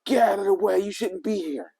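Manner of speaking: high, loud mock girl yell; loud, high impression of a girl yelling; imitated yell. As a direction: bark it like an angry kid. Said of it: "yells at me".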